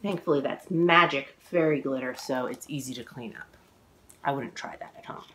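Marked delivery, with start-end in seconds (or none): 4.2-5.2 fast whispered warning; whispered aside. Real, not acted. Author aside to young listeners, whispered. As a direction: lean in and whisper a secret tip.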